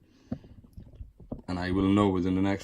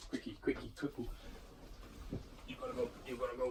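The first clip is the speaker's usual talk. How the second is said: hushed hurry; 3 semitones higher, 15 dB quieter, faster than usual. Real, not acted. Hurrying dog out quietly.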